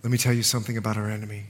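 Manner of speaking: low, soft, grave warning; solemn preaching. Real, not acted. Preacher warns congregation about the enemy, solemn and hushed.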